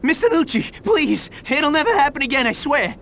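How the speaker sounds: high, hurried frightened plea; desperate begging. Acted. Underling begging crime boss Mister Nucci, desperate. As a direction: plead fast and high for your life.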